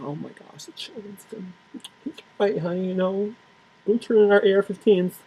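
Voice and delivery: hushed, high, mocking gasp; mock hushed couple. Mocking parents reacting to gun-control pitch, hushed and high. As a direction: whisper it like a fretful wife to husband.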